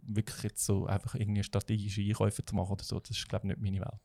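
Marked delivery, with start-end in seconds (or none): none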